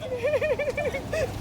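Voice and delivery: loud, squealing-high laugh; real high laughter. Real, not acted. Friends arriving in Times Square, high loud laughter.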